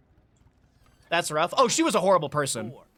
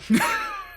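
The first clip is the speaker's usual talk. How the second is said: high laugh burst. Real, not acted. Recalling teacher's cackle.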